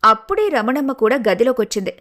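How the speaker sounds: usual speaking voice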